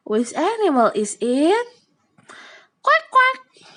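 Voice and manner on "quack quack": high loud quacking; duck quack. Pretend. Teacher imitating duck.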